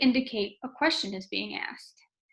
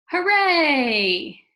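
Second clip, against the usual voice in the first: loud, higher drawn-out cheer; exclaimed cheer; 4 semitones higher, 9 dB louder than usual. Shown as a demonstration. Teacher demonstrating an exclamation point, cheering. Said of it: "we are gonna use an exclamation point".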